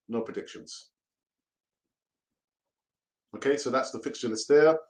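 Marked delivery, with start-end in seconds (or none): 0.1-0.9 "No predictions" dropped much softer and lower; muttered refusal. Real, not acted. Fan repeating the refusal to himself, softer and lower. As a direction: trail off softly on "No predictions".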